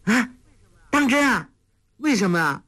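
high-pitched delighted disbelief; overjoyed. A narrator's reading. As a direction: blurt eager questions, voice leaping up. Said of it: "黄蓉大喜， 问道". (大喜过望地尖声追问)

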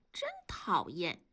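put-on child's whispered grumble. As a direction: hiss it under your breath like a sulky kid. Child grumbles about grandma.

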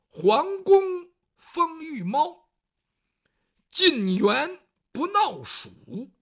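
very slow, loud, drawn-out chanted verse; poem recitation. Acted. Storyteller reciting the swordsman's wall poem, slow grand declamation. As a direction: declaim each line like a proud ballad. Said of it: "这诗上是这么写的".

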